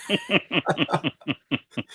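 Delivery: high-pitched rapid chuckle; amused high giggle; laugh. Real, not acted. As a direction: laugh in a high, descending run. Laughs at his own singing remark.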